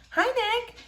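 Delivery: put-on shy girl greeting a boy she likes.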